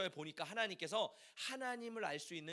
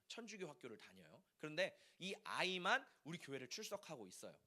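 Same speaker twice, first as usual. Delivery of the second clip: ordinary talk, nothing marked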